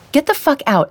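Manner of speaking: high, sharp furious command; knocked to the floor, she yells at her ex; furious outburst. Acted. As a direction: throw him out with full fury. Knocked down, orders him out.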